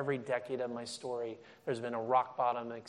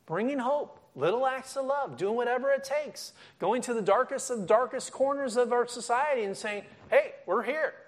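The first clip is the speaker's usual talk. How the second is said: high, loud fervent preaching; rally the congregation with rising zeal; fired-up sermon climax; preaching at full force; 10 semitones higher, 4 dB louder than usual. Real, not acted.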